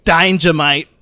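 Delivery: hammed-up Australian impression; Steve Irwin. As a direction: play up a broad Aussie croc-hunter accent. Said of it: "it just reminded me of Steve Irwin".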